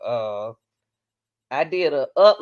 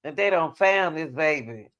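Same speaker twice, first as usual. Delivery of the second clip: ordinary talk, nothing marked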